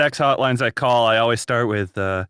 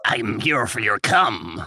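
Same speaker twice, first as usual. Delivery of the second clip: a hint of a creepy goblin; 2 semitones lower, slower than usual. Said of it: "the Cum Goblin voice".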